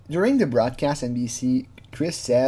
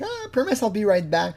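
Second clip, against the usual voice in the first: stuck decoy; 8 semitones higher than usual. Retold or imitated. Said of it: "the decoy was kinda stuck".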